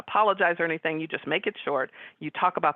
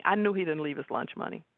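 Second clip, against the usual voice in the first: ordinary talk, nothing marked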